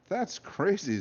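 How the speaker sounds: put-on high, scoffing boy; high kid voice. A narrator's reading. Luke scoffs.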